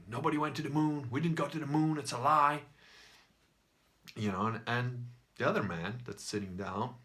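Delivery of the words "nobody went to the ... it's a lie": higher, slightly ranty shouty-man impression; a little bit ranty, building louder at the end; mild rant. Shouty man in a beer hall denying the moon landings, mildly ranting. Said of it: "sort of a little bit ranty and saying".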